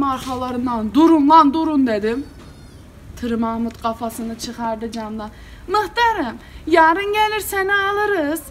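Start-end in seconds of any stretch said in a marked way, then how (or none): 0.9-1.9 loud shout of stop, calling after the car; full-voiced yell to a car driving away; yelling after them. Calling after his stolen car. 5.7-8.4 high, slow carefree voice of the villager; the driver leaning out of the window, high and slow; mocking the driver.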